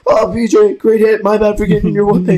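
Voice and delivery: squeaky high, fast sorry-voice; mock apology voice. Coach quoting him apologizing in a squeaky high voice. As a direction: pipe up sweetly, apologizing too much. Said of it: "Coach DeLuca's like".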